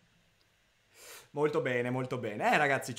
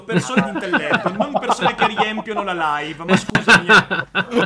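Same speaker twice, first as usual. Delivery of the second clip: louder laughing retort; laughing protest; 8 dB louder, slower than usual. Real, not acted. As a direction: push back while cracking up.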